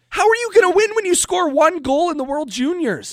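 loud, very high-pitched incredulous question; exasperated rant. Real, not acted. Host arguing a team can't win like that, exasperated. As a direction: demand an answer, worked up and indignant.

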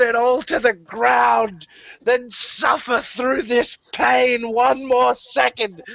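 a grieving mother screaming at the intruders; angry crying yell. Acted. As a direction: scream it through tears, losing control. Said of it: "burst into angry cry yelling".

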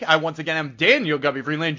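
normal talking voice

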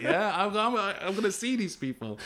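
high, loud, half-laughing plea; exasperated, laughing protest. Retold or imitated. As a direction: laugh through the disbelief as you protest. Arguing with mate over mural.

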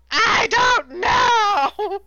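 high, loud, drawn-out panicked yell cracking into laughter; freaking out. Retold or imitated. Demon admits defeat to Dream, a panicked laughing yell. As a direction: wail it out like a cornered demon losing it. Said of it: "straight up freaks out".

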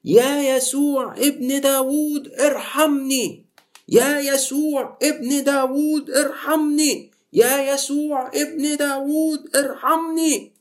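high, slow, drawn-out pleading cry; desperate high plea, stretched out; crying out for mercy. Acted. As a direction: wail it like a blind beggar begging to be heard. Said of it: "الإنجيل بيقول يصرخ".

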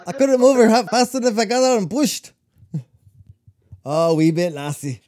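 high, loud Scottish brogue; Scottish engineer complaining, hammed up; Scottish accent. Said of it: "I'm good with accents".

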